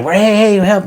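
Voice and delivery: high-pitched retold holler; mock call for help. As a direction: act out calling over for help. Retold cry for help on riverbank.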